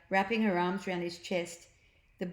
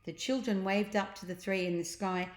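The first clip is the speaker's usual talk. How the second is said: ordinary delivery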